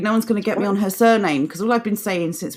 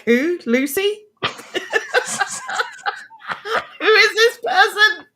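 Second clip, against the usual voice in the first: laughing mock bafflement; 10 semitones higher than usual. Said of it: "'Cause they're like".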